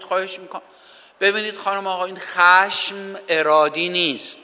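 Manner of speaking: normal talking voice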